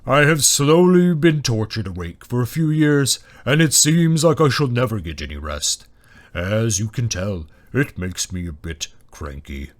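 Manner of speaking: slow, weary, booming dragon; ponderous dragon, tired and cranky; groaning dragon. A narrator's reading. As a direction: groan a complaint as a great beast. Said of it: "the dragon broke into a groan".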